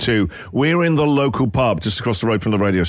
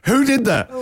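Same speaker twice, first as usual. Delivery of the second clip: high, indignant demand to know the culprit; angry demand; 11 semitones higher than usual. Retold or imitated. Celebrity confronting the pub over his song, high angry demand. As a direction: snap it out, demanding who's to blame. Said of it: "And then goes".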